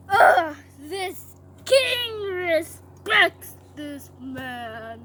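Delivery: very slow, strained mock-angry proclamation; angry, grudging. Haman made to honor Mordecai, angry and grudging. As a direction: force each word out in disgust. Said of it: "like an angry dog".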